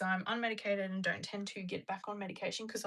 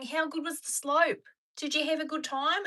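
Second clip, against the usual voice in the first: high, mimicked New Zealand accent; chatty imitation of a New Zealand accent; 7 semitones higher, 4 dB louder than usual. Retelling locals asking about the slope, New Zealand accent. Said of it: "you have to stop doing the accent".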